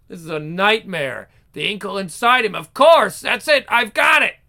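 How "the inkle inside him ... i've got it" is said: ordinary delivery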